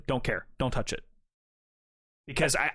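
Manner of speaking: ordinary delivery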